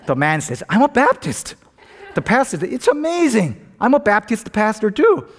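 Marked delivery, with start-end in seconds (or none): 0.7-1.5 high, eager announcement; acted delight, pitched well up; excited reply. Jumper answers with growing excitement. 2.7-5.3 very high, thrilled exclamation; acted, hammed-up excitement; amazed delight. Joke pastor thrilled at match.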